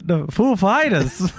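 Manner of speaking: slow, laughing impression of the name; Christopher Walken. Friend re-doing the Walken announcement, slow and laughing. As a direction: stretch out the band name, half laughing. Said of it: "Can you imagine?".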